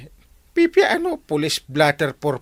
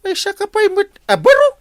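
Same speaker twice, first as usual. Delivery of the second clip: much higher, louder rebuke; high scolding; 5 dB louder than usual. Acted. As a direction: scold him sharply. Captain scolds Kwarog.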